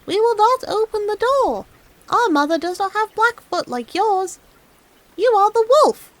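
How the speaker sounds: put-on high, loud kids' refusal; little kids shouting at the wolf; kids crying out. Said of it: "The children saw it and cried out".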